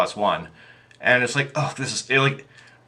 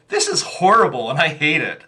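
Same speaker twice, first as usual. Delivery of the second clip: high, loud reenacted dismay; loud, high-pitched replay of being appalled; retold distress; 7 semitones higher, 5 dB louder than usual. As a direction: exclaim it as a mock outburst of horror. Retelling own upset reaction to module.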